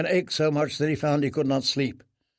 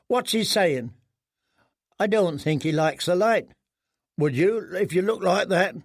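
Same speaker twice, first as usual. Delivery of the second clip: lightly voiced mocking boy; 5 semitones higher than usual. Boys mocking prisoner.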